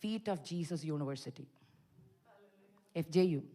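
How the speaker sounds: soft, slow reverent; hushed, slow "Feet of Jesus University"; reverent naming. Shown as a demonstration. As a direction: say "Feet of Jesus University" softly and slowly with weight. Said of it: "എൻ്റെ Bible സ്കൂളിൻ്റെ പേര്".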